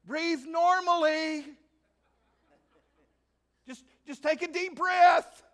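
loud, high shouted calming words; shouted reassurance. Retold or imitated. God shouting down soothing words to a worrier. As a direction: yell the comfort down from heaven. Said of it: "shout down to you".

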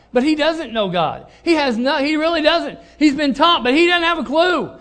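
ordinary talk, nothing marked

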